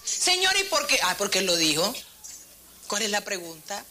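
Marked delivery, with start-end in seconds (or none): 0.0-1.0 high-pitched questioning interjection; mock protest. Preacher mimics believer questioning God after singing, mock protest. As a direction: break in with an imitated complaint to God.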